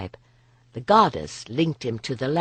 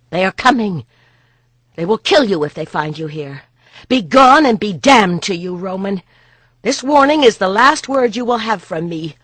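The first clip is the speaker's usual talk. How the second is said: loud, higher furious dismissal; urgent angry warning; 5 semitones higher, 9 dB louder than usual. Acted. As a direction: drive him out before the horns. Boudica drives Pollio away.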